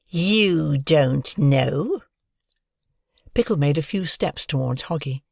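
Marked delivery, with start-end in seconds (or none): 0.1-2.0 slow, mocking bully drawl; sneering taunt. Bully Pickle taunting Hoggy, slow sneer. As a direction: drag it out to mock her.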